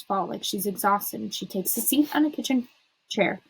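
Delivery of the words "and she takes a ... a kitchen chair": plain reading voice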